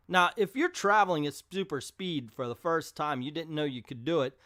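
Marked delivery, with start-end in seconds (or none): none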